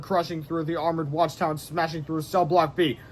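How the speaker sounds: ordinary delivery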